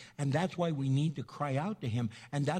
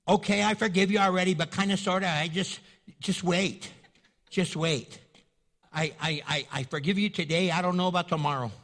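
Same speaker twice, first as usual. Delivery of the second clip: loud, fast, half-hearted forgiving; grudging forgiveness; 5 dB louder than usual. Retold or imitated. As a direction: blurt out a grudging forgive, louder and quicker. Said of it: "kind of forgive like our husband or our wife".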